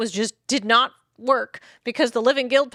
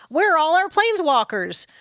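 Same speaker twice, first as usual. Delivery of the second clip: indignant rant; 8 semitones higher, 5 dB louder than usual. Retold or imitated. Voicing angry Ravnicans at planeswalkers.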